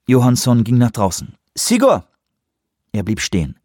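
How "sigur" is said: much higher call after him. Acted. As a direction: call his name as he leaves. Lee calls after him.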